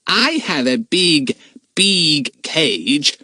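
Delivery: slow, high, dramatic delivery. Acted. Jack teasing Gus, dramatically. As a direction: lay it on thick to scare him. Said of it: "he said most dramatically".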